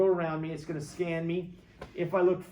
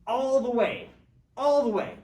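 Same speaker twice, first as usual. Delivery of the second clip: higher and louder, hammering it home; loud emphatic repeat; 8 semitones higher, 8 dB louder than usual. Real, not acted. Teacher tells students how close families once came, loud and emphatic. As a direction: insist on it, each time loud. Stressing how far people could go.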